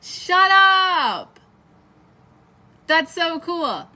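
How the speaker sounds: high, loud shrieking disbelief; excited disbelief. Real, not acted. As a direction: yell it in happy shock. Only one person shares birthday.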